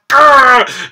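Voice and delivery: loud, high frustrated growl; annoyed growl. Acted. Fan annoyed by the breakup, frustrated growl. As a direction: growl out your exasperation. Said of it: "I'm so annoyed by that".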